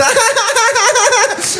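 high, forced polite giggle; courtesy laugh. Retold or imitated. Comedian mimicking his own forced courtesy laugh, high. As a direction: fake a tiny polite laugh. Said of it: "Vous voyez ce rire-là?".

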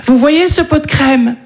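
high, slow, cooing sales pitch; influencer voice. Retold or imitated. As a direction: coo like a beauty influencer. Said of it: "elles disent".

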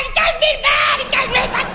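high-pitched frantic raving; hysterical boss imitation. Imitating boss Gérard raging over stolen money, hysterical. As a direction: squeal it like a man losing his mind. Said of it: "sur un mode complètement hystérique".

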